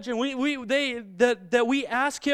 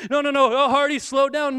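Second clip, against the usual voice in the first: higher, louder urgent protest; mock alarm; 4 semitones higher, 4 dB louder than usual. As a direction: rush in to stop a child, half in earnest. Acted doubting parent halting child.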